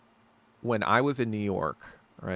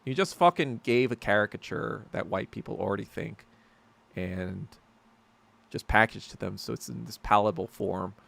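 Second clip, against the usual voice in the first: ordinary talk, nothing marked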